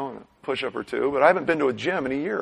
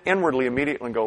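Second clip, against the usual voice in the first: ordinary talk, nothing marked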